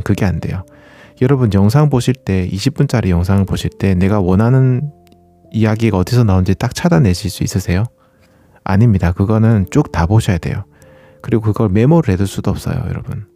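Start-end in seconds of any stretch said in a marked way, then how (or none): none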